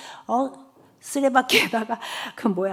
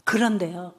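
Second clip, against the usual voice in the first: ordinary talk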